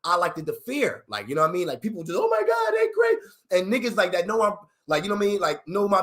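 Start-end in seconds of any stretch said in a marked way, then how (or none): 2.2-3.2 squealed, high-pitched mock admiration; hammed-up gasp of amazement; mock awe. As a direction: play the starstruck crowd. Imitating impressed onlookers.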